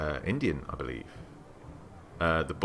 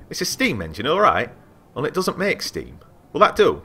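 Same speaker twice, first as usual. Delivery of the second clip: a lightly voiced testy mechanic, rising higher; impatient artificer; 8 semitones higher, 6 dB louder than usual. Artificer snaps at the curious traveller, lightly voiced. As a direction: brush off a silly question, a bit higher.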